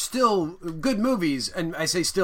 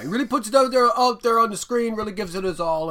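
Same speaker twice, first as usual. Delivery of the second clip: higher, louder sports announcer voice; 7 semitones higher, 7 dB louder than usual. Pretend. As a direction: hype it up like a sportscaster. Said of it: "why do I sound like a sports announcer".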